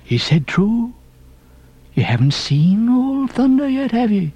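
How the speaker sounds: insane earnestness. A narrator's reading. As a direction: press the question with odd fervour. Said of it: "the insane earnestness of his manner".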